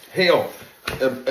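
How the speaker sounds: ordinary delivery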